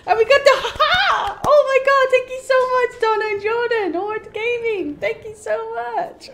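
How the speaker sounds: high-pitched, excited gushing gratitude; overjoyed thanks. Real, not acted. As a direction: gush your thanks high, easing down in volume. Thanking people after milestone.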